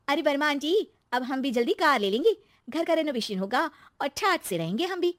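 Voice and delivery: excited daughter-in-law. Acted. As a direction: share good news with the neighbour excitedly. Narrator voices Shweta. (खुशी से इतराती बहू)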